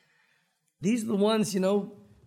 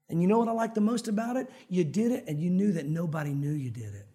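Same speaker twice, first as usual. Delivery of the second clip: normal talking voice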